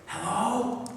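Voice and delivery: put-on soft little child whispering; child's whisper. A narrator's reading. A small child whispering into the phone. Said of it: "he hears a child's voice whisper".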